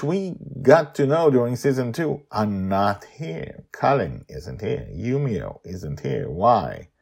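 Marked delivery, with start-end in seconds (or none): none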